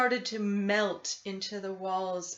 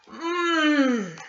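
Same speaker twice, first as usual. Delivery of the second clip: mmm of delight; 5 semitones higher, 6 dB louder than usual. A narrator's reading. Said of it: "said Camila".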